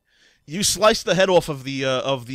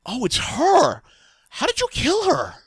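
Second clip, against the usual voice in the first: surprised captain; 14 semitones higher, 5 dB louder, slower than usual. Acted. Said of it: "takes a closer look at the head and goes".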